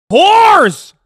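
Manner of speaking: hammed-up yell; mock outraged yell. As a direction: scream it in fake outrage. Mock outrage at TikTok men.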